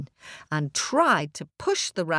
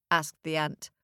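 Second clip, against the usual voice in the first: plain reading voice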